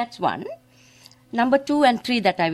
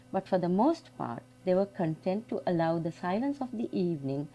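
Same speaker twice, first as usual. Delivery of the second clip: soft, unhurried reading of a quiet evening scene; story reading; 4 dB quieter than usual. Acted. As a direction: let the evening settle as you read. Reads literary passage aloud.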